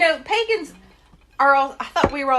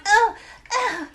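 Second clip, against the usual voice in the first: high, whiny mock-crybaby voice, louder; mocking whiny snowflake; 5 semitones higher, 4 dB louder than usual. Mocking Trump critics as crybabies, high and whiny. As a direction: whine it out like a fragile crybaby. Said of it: "everybody's running around".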